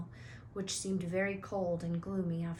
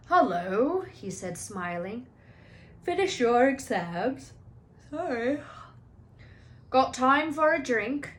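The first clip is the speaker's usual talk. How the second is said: a hint of big friendly gamekeeper, cheerful; lightly voiced jolly giant offering a drink; Hagrid's voice; 5 semitones higher, 8 dB louder, slower than usual. Hagrid's voice beside narration.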